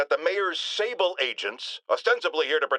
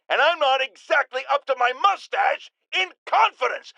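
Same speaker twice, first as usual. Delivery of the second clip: shouted rant; 7 semitones higher than usual. Acted. Ranting host shouts his doubt.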